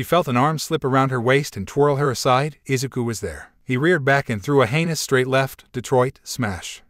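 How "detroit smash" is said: plain reading voice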